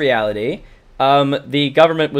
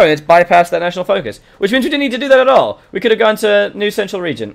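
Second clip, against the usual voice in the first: higher and louder, words tumbling out; excited rush; 6 semitones higher, 5 dB louder than usual. Real, not acted. Streamer thrilled that a national focus got skipped, excited. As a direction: share a happy discovery.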